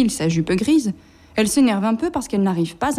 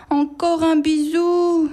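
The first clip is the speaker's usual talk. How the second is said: high, slow, begging for one more kiss; pleading; 8 semitones higher, slower than usual. Acted. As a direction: plead like a clingy mum at the gate. Mamans pleading at school gate.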